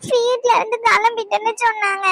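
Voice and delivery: crying between short phrases; upset, catching breath as she explains; tearful. Acted. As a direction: explain through sobs. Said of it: "இதுக்கு மேல அழுதனா".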